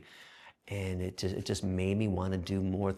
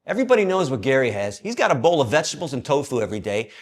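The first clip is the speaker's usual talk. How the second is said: loud, higher angry demand; angry complaint; 6 semitones higher, 8 dB louder than usual. Retold or imitated. Gordy confronting the warden over food, loud angry complaint. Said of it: "And Gordy says".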